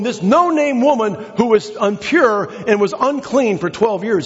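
building sermon fervor; full-force preaching. Acted. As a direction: drive the point home to the church. Preaching, voice raised.

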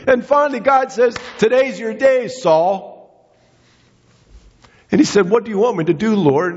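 fervent preaching. Acted. Preacher stirring the congregation about Saul, fervent and high. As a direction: preach it with rising fire.

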